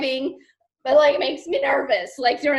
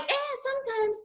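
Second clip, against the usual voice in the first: dismissive; 11 semitones higher, 5 dB quieter than usual. Retold or imitated. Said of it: "There's not like".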